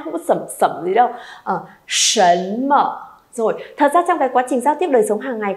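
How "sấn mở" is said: "sấn mở" very slow, syllables stretched; slow teacher's model of "sấn mở"; careful pronunciation model. Shown as a demonstration. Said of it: "Đừng có ai đọc là sẩm ma".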